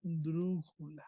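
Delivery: soft, drawn-out "esdrújula"; slow dictation. Shown as a demonstration. Teacher writing the answer on the board, slow soft dictation. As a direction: say it slowly and low-key while writing. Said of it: "Vamos a ponerle aquí".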